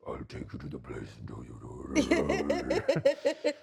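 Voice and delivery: low, slow eerie voice; creepy sleep-talk voice. Retold or imitated. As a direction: drop low and slow, spooky. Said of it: "Do the voice".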